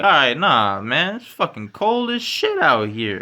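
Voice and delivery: exasperated plea. Retold or imitated. Podcaster retelling telling housemates to bring the dogs in, rising exasperated plea. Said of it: "I'm like".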